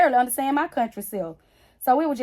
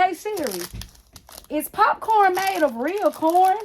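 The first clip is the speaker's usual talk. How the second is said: put-on child asking Siri; 7 semitones higher, 4 dB louder, slower than usual. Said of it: "She said".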